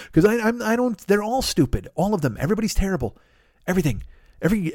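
usual speaking voice

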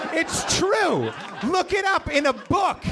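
ordinary delivery

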